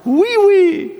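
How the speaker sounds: high and loud French oui, oui; bright, sung-out French yes. Shown as a demonstration. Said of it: "The French".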